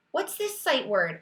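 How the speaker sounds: ordinary delivery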